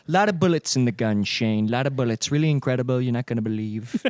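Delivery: lightly imitated politician, low and trailing off; Trump. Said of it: "Now that's Trump".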